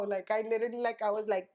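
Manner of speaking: normal talking voice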